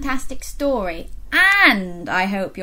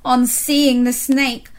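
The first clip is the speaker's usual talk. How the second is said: usual speaking voice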